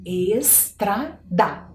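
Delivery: teacher slowly sounding out "Estrada"; slow spelled-out word. Shown as a demonstration. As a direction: stretch "Estrada" very slowly, every syllable clear. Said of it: "Procurem a letra E, S".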